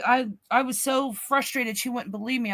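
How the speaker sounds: ordinary delivery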